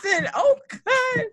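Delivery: very high, squealed reaction; amused mock exclamation. Amused retold reaction to the child.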